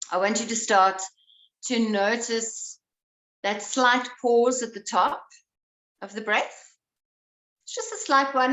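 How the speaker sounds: calm, unhurried guiding voice; breath coach easing the class into awareness; guided breathing. Acted. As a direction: lead them gently into noticing the breath.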